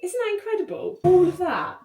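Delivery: high-pitched delighted gushing; real excitement. Real, not acted. Showing off the flower displays, high and excited. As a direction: squeal with pride, voice leaping up.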